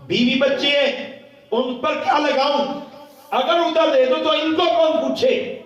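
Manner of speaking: loud, high-pitched impassioned sermon; full-force preaching. Acted.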